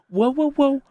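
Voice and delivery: very high, loud mock protest; alarmed backpedalling. Tester halting the manager's bomb talk, high alarmed backpedalling. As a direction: cut in high, trying to halt the panic.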